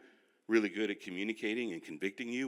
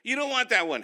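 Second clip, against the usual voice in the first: imitating salesman; 13 semitones higher, 8 dB louder than usual. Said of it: "used car dude".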